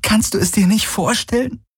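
very high, imploring question; almost pleading. A narrator's reading. She takes his hand and asks, almost pleading. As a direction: plead gently, voice pitched high. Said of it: "fast flehend".

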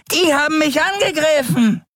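very high, shouted skeleton voice; bizarre voice. A narrator's reading. Skeleton accuses them of attacking, bizarre high shout. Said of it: "verkündete seine bizarre Stimme".

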